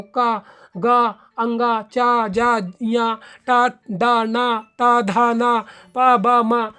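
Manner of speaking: each syllable called out loud and short; demo syllables. Shown as a demonstration. Teacher sounding out short syllables for the class, loud and clear. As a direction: sound out का, गा, अंगा one by one, crisp and loud. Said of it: "जैसे कि आप बोल कर देखो".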